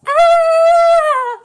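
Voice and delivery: high, loud cry of "ah"; hammed-up wordless wail; mock-anguished wail. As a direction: burst out in overdone grief. Wailing over the story.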